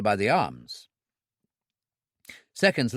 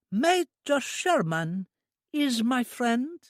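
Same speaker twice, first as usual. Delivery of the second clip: put-on high, slow simple girl's voice; an eager, innocent young woman; high, drawn-out childlike voice; childlike Trudy; 11 semitones higher, much slower than usual. A narrator's reading.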